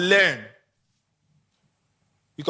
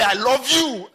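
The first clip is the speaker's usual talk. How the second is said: higher and louder, overplayed; unconvincing I love you; 7 semitones higher, 4 dB louder, slower than usual. Someone declaring love, higher, louder and overdone. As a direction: push it too hard to be believed.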